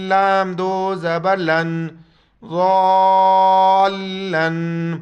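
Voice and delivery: ضالن with lam pressed hard and held; firm doubled lam. Shown as a demonstration. Teacher to students, firm shadda on lam. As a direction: stress the doubled لام in ضالن firmly. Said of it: "سختی سے پڑھنا ہے".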